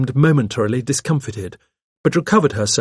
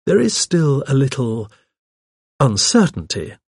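halting, with a pause before the end; slow, hesitant; 3 semitones higher than usual. A narrator's reading. Vicar hedging on what police think, slowly. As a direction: choose the words slowly. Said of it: "I said slowly".

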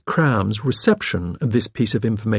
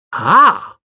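high, louder exclamation; excited cry; 10 semitones higher, 7 dB louder than usual. A narrator's reading. Secretary pouncing on the vicar's hint, crying out. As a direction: seize on the news with a cry. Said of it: "cried Miss Cram".

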